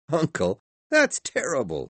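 dismayed cry. A narrator's reading. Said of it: "cried Scrooge's nephew".

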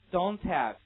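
"Don't have" loud, t joined onward into have; linking through h. Shown as a demonstration. Teacher drilling h linking for students, loud linked reading. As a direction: model "Don't have" as one linked unit. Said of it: "这也是一样".

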